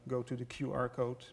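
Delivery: ordinary delivery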